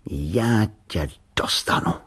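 slow, menacing whisper; dangerous voice. A narrator's reading. Boy threatening the tin can, dangerous voice. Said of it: "řekl nebezpečným hlasem".

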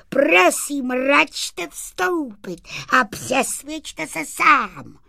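high, loud pitchman voice for a little sprite; sprite's proud, high-pitched sales patter; boastful little sprite. Acted. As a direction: show off your invention in a high, ringing voice. Sprite touting his invention.

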